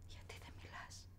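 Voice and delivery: very soft whispered ask; whispered question. Acted. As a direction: whisper it gently. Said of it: "της είπα".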